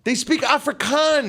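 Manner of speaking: high, indignant objection; exasperated insistence; heated correction. Real, not acted. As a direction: cut in with a high-pitched rebuttal.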